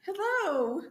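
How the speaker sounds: high sweet mock voice; lightly voiced little girl; imitating her daughter. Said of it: "She be like".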